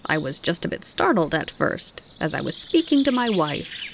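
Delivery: a lightly rasping insect voice; rasping grasshopper. A narrator's reading. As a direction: rasp like a small grasshopper. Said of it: "in his rasping voice".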